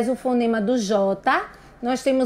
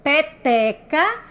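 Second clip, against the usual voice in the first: "Peteca" slowly sounded out; slow, measured "Peteca" with the end lifted; syllable by syllable; 3 semitones higher, slower than usual. Shown as a demonstration. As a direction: stretch each syllable of "Peteca" for a class. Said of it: "A sílaba do meio".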